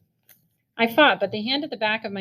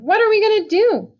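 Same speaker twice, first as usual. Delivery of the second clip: put-on boy panicking about the electric chair; panicked near scream; 10 semitones higher than usual. A narrator's reading. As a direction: blurt it out on the edge of a scream. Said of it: "I nearly screamed".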